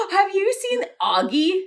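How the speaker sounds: high, gasping excited question. Real, not acted. Host asking co-host about a favourite bird, excited. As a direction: burst in with a delighted discovery.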